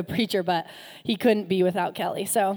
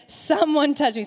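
calling out; 5 semitones higher, 6 dB louder than usual. Acted. Jesus calling out in crowd.

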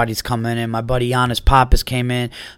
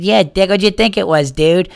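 mock buddy voice, higher and louder; imitating Bobby's voice; 6 semitones higher, 3 dB louder than usual. Comic replays Bobby Kelly's teasing voice, higher and louder. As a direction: lightly mimic a teasing friend. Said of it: "and his voice goes".

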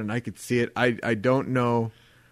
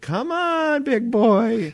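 high, teasing lure; mock come-hither call; coaxing stage girls; 10 semitones higher, 3 dB louder, much slower than usual. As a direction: coax him up playfully.

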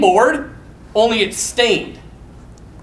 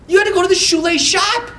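ordinary delivery

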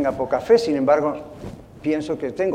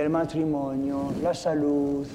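ordinary talk, nothing marked

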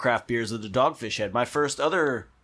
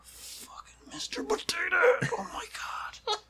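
slow, much softer tearful whisper; choked whisper; 6 dB quieter than usual. Shown as a demonstration. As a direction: whisper it as if near tears. Demo of earnest emotional delivery.

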